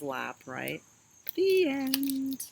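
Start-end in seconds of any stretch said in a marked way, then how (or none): none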